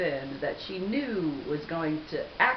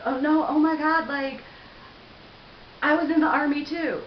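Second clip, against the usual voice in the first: high, loud imitation of a gusher; hammed-up excitement; mock gushing; 9 semitones higher, 6 dB louder than usual. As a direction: gush it like an overeager stranger. Mimics the guy gushing again.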